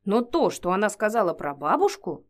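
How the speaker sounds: usual speaking voice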